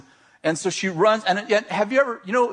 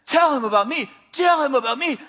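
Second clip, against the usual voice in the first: higher, insistent command; more intense; 6 semitones higher than usual. Retold or imitated. Inner voice keeps pressing the speaker, intense and insistent. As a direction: press the order harder each time. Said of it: "this voice is getting more intense".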